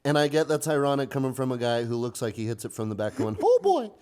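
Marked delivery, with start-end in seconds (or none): 3.4-3.9 high goofy cartoon exclamation. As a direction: squeal it like a cartoon dog. Goofy-style voice during sex.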